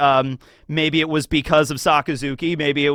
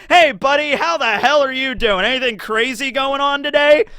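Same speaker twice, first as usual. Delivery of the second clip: chipper greeting; 8 semitones higher than usual. Retold or imitated. Cheery call to a friend.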